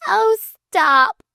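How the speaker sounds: high, loud distressed protest; cried out. A narrator's reading. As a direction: cry out to cut him off. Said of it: "cried Flame".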